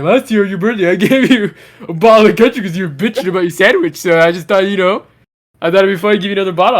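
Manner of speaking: a light put-on buddy voice, the last line rushed; lightly voiced friend explaining his prank, sped up at the end; imitating Calvin. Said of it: "He's like".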